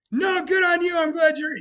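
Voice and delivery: high, loud good-natured shout; loud mock-gracious cheer; loud congratulations. Real, not acted. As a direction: shout praise to a friend who won. Joking congratulations shouted to friend.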